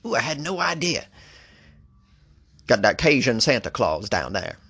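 rough attempt at Cajun accent; clumsy Louisiana Cajun twang. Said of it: "I can't do a Cajun accent".

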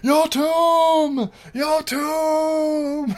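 high, slow repeated declaration; epic villain roar. Retold or imitated. Vampire to the cops, slow high booming threat. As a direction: proclaim it grandly, fading.

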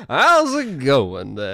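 slow, drawn-out put-on American greeting; American accent. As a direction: stretch it out in a mock American accent. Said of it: "the only American accent I can do".

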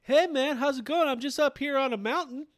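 high, loud, bubbly caller impression; Ryan on the phone. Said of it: "Ryan will call me on the phone".

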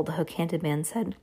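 ordinary delivery

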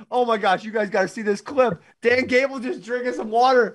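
high, giddy and eager; bubbling over about a funny clip; excited. Real, not acted.

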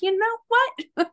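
gleeful defiant payback; laughing defiance. Retold or imitated. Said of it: "I was like".

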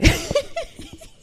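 high loud laugh. Real, not acted. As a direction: burst out laughing.

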